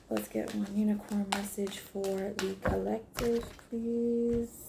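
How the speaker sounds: quiet plea to the cards; soft request. Real, not acted. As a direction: murmur the request gently. Soft request while shuffling.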